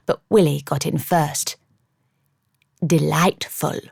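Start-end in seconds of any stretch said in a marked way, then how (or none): 2.9-3.8 put-on a deliberately dreadful English accent; an American hamming up an English accent; bad English accent. A narrator's reading. Willie mocking the table in a dreadful English accent. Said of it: "in a dreadful attempt at an English accent".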